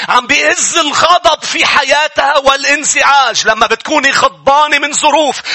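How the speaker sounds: high, loud fervent sermon delivery; impassioned pulpit preaching; full-force preaching. Acted. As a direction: preach it out to the whole congregation.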